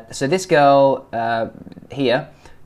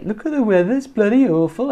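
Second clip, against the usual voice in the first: higher, animated mock Birmingham accent; 9 semitones higher, 3 dB louder than usual. Coach copying the guy's weather line, animated Birmingham accent. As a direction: imitate an over-animated Brummie. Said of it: "this guy must live in Birmingham".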